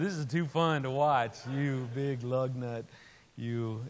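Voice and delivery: a touch of amused mockery, slowing at the end; wife enjoying his predicament; lightly voiced teasing wife.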